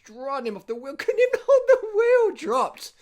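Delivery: high, loud, breaking into a laugh; giddy shout over the race footage; laughing excitedly. Real, not acted. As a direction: cackle with delight as he gets dropped. Gleeful at rider dropped.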